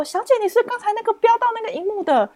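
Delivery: high, put-on auntie asking the buyer. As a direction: play a nosy auntie. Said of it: "她也说".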